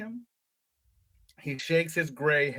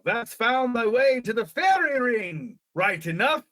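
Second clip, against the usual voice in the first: put-on high, loud old English shepherd; hammed-up rustic English accent, sung out; funny old-country English accent; 8 semitones higher, 5 dB louder, slower than usual. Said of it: "in that funny accent that all the old people".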